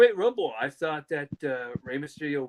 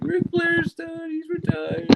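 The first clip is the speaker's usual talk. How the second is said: high, slow wailing through tears; retold sobbing to grandma, pitched high and slow; slower than usual. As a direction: fall apart crying over the news. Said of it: "I lost my shit".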